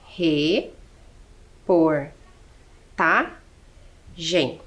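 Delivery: "re, por, tá, gen" as four separate syllables with long pauses. Shown as a demonstration. Teacher forming the word from its syllables, syllable by syllable. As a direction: sound out each syllable alone: re... por... tá... gen. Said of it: "Então, formamos".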